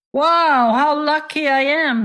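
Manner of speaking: high delighted exclaim over the purse; thrilled at his luck; excited traveler. Acted.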